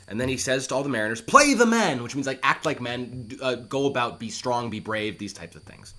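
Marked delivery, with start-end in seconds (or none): 1.2-2.0 command. Acted. King orders sailors.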